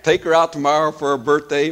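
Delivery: ordinary talk, nothing marked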